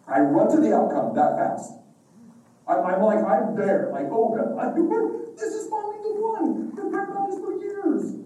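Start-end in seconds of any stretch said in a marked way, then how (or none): 4.1-8.2 overjoyed inner voice. Retold or imitated. Imagines child finally changing, overjoyed.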